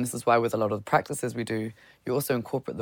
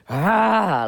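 primal caveman roar; 7 semitones higher, 6 dB louder than usual. Shown as a demonstration. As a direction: let out a primal yell. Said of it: "like a real, like, primal caveman".

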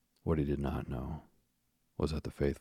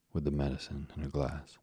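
usual speaking voice

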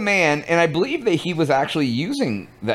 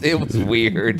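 ordinary talk, nothing marked

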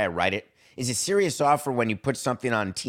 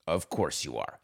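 sarcastic aside; 7 semitones lower, 6 dB quieter than usual. Pretend. Sarcastic aside to team pitch.

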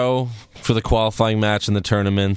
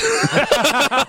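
high burst of laughter; laughing; 12 semitones higher than usual. Real, not acted. Cracking up after quoting the wrestler, high laugh. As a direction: crack up at your own impression. Cracks up at his own impression.

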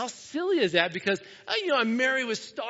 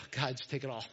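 low, soft plea to God; weary surrender; 6 semitones lower, 8 dB quieter than usual. Retold or imitated. Pastor to God after a long emotional week, weary surrender. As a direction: let it go in a quiet, sighed prayer.

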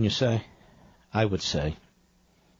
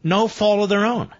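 scornful mocking quote; 8 semitones higher, 3 dB louder than usual. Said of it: "This line, as I said the first hour".

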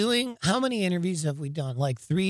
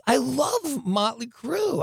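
higher, slow fan cry of love for the band; a giddy, higher fan's shout, drawn out; mock fan frenzy; 8 semitones higher, 3 dB quieter, slower than usual. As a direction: relive being out of your mind at the show. Reliving frenzy at concert.

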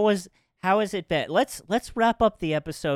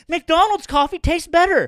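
high, loud, slow outburst; mock-outraged yell, words drawn out; imitated freak-out; 13 semitones higher, 8 dB louder, slower than usual. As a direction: imitate someone freaking out over coffee. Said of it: "he's, like, freaking".

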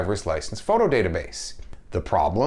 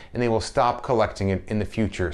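usual speaking voice

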